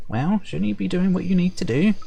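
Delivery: high, teasing sprite voice; perky high sprite. Acted. Sprite nudging the player onward, perky and high. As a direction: lift it high and chirpy. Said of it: "perky, high-pitched voice".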